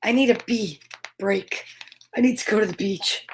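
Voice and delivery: usual speaking voice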